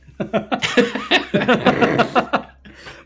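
a burst of real laughter, high; can't keep from laughing out loud. Real, not acted. GM cracks up.

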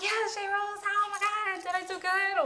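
imitating herself in a high, excitable voice; squeal-like pitch as you gush for approval, mocking yourself; eager gushing. Guest asks host for approval after coming off stage, mock eager gushing.

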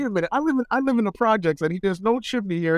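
high mock-confused kid voice; a high voice, baffled by the dream; incredulous kid. Telling a dream about parents, high puzzled kid voice.

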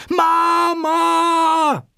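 piercing, drawn-out, very high yell; very loud scream. A narrator's reading. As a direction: yell for mother at full force. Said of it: "dann schreit sie ganz laut". (lauter, lang gezogener, hoher Schrei)

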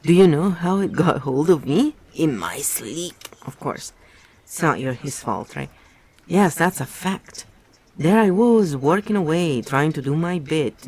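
hammed-up Parsons confiding. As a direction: ham up the eager confession. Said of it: "Sorry, I just had to do it".

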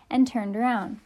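ordinary delivery, nothing marked

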